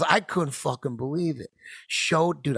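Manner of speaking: usual speaking voice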